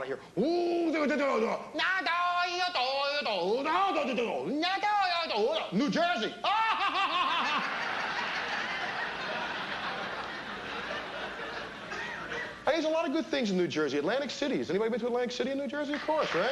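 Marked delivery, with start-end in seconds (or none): 0.4-6.3 high gibberish imitation of Japanese TV; hammed-up mock Japanese sitcom voice. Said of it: "I hear a Japanese sitcom on". 6.4-12.6 long, high put-on laugh; high staccato fake cackle; mock sitcom laugh.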